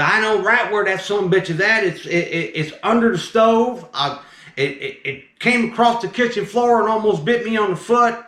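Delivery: high-pitched, rambling southern man; southern accent. Retold or imitated. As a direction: imitate a worked-up southern customer. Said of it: "he's talking with his southern accent".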